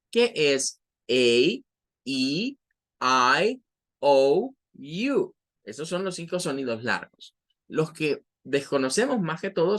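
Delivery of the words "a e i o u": A, E, I, O, U as English letter names, one by one; five long vowels. Shown as a demonstration. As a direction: recite A, E, I, O, U long, with gaps.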